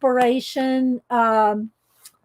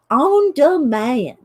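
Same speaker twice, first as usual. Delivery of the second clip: slow, exaggerated Southern accent; slower than usual. Shown as a demonstration. Host repeating the phrase to show it off, Southern accent. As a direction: stretch each vowel in a thick Southern accent. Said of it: "We can do a Southern accent".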